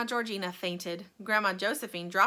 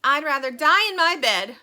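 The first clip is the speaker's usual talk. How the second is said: put-on loud, higher stubborn old woman's shout; 6 semitones higher, 12 dB louder than usual. A narrator's reading. Grandma Josephine refusing to leave her bed, loud shout. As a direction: yell it out in flat refusal. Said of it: "shouted Grandma Josephine".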